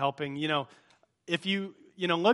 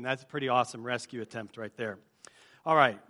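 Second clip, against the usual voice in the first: ordinary delivery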